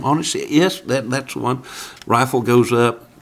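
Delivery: ordinary talk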